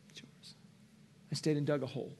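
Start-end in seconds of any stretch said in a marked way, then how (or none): 0.1-0.5 barely audible whisper; whispered surrender. Retold or imitated. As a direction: let it go in a breath. Final surrender retold.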